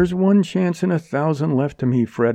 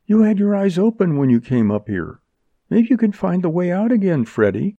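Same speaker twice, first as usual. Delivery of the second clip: higher-pitched, sweeping appeal; urgent coaxing; 9 semitones higher, 3 dB louder than usual. A narrator's reading. Woman begging Freddy to fight for her, urgently coaxing. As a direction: press him eagerly, carrying him along. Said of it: "trying to sweep him along with her".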